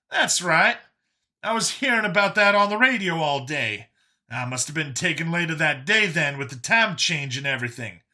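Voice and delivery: high, animated voice; Brian, excitable talkative survivor. Acted. Brian amazed at Vincent's story, high and excited. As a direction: pitch it high and lively, amazed by the news.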